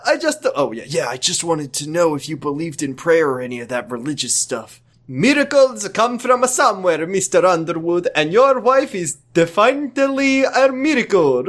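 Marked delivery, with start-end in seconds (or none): 5.1-11.4 higher comic Italian doctor; lightly hammed Italian doctor; doctor reassures the husband, in a mild Italian accent. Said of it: "you're going a little Dracula".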